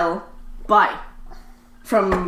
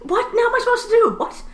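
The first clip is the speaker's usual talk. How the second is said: high-pitched flustered reply; hammed-up bafflement, pitched way up; mock exasperation; 10 semitones higher than usual. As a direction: play someone thrown by morbid gossip. Acted reply to a rambling gossip.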